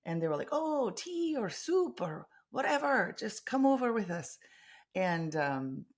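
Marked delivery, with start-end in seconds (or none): 0.5-4.4 a much higher, slower Scottish accent put on as an invitation; mock Scottish brogue. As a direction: invite the visitors like a friendly Scottish local. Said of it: "I'm massacring your beautiful accents".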